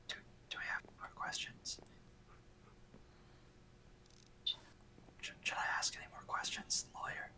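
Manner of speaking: hissed whisper meant to carry; whisper yelling. Real, not acted. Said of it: "You don't have to whisper shout".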